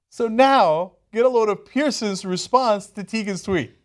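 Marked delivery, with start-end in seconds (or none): none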